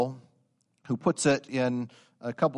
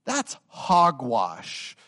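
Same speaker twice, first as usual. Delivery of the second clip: scornful dismissal; 3 dB louder, much slower than usual. Real, not acted. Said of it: "what R C Sproul calls this".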